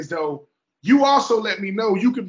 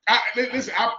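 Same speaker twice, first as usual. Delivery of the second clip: laughing while teasing, higher and louder; 5 semitones higher, 8 dB louder than usual. Real, not acted. Teasing his friend about the lost bet, laughing out loud.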